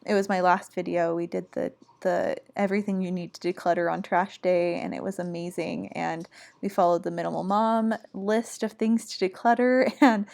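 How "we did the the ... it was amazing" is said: usual speaking voice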